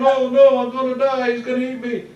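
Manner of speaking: high, loud comic terror fading out; mock panicked scream. Teller replaying his own panic at the bear, high mock scream. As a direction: holler like the bear will eat you. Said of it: "and I'm screaming".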